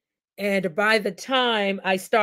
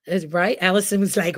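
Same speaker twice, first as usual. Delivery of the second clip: ordinary delivery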